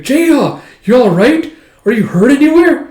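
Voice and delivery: high, loud, urgent questioning; alarmed concern. Retold or imitated. Finding Jeha outside, possibly hurt, alarmed. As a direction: call out urgently to check for injury. Calls anxiously to found friend.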